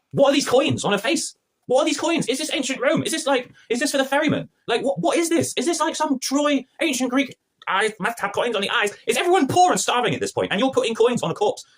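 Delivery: high-pitched exasperated questioning; baffled squawking disbelief; incredulous rant. Real, not acted. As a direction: demand answers, voice up high throughout. Ranting at absurd burial scene.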